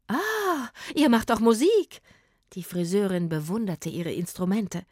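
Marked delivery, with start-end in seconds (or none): 0.1-2.0 delighted. Acted. Hairdresser delighted.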